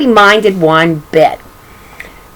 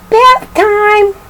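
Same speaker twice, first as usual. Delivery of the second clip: much higher, singsong mother calling; high mama voice; 12 semitones higher than usual. Acted. Mother calling the baby for bath, high and singsong. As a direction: sing it out high like a mom calling. Mama's line in story.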